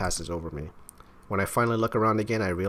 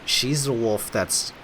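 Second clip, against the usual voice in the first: usual speaking voice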